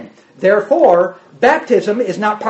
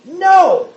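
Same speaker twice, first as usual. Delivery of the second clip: "No!" flung much higher; emphatic no; 10 semitones higher, 3 dB louder than usual. Shown as a demonstration. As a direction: bark the single word "No!" hard.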